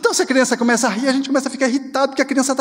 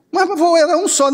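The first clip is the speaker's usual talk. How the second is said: put-on a raised, childlike protest; child's protest; 8 semitones higher than usual. Grandchild protesting to grandpa, mildly whiny. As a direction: whine it up high like a small grandchild.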